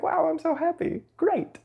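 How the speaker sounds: playful high burst of pretend joy; happy. Guide voicing someone noticing joy in the body, playfully happy. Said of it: "If you feel joy in your body".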